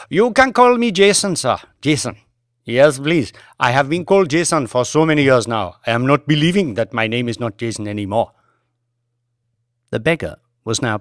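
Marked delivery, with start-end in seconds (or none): 0.1-2.2 put-on higher, quick and apologetic, Indian-accented driver; a driver anxiously excusing himself to his passenger, Indian accent. Driver offers his adopted name. 2.6-8.3 put-on a driver anxiously excusing himself to his passenger, Indian accent. Driver explains his name to Banks.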